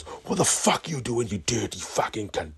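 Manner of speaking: high, drawn-out opening, mock outrage; angry confrontation. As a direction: act out an indignant accusation. Outraged stranger confronts him at window.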